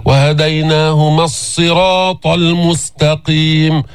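slow chant linking وهديناهما directly to the doubled ص of الصراط; flowing tajweed recitation, long vowel in الصراط; straight into sad. Shown as a demonstration. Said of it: "دغري فوت بالصاد المشددة".

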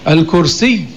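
الكرسي as "al-kursiyy", final y doubled and held; correct classical pronunciation. Shown as a demonstration. Teacher corrects the colloquial word, shadda held. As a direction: press a clear u, then a long doubled y in الكرسي. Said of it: "لا يوجد في القرآن".

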